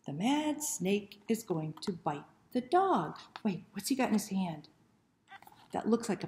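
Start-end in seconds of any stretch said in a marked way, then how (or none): none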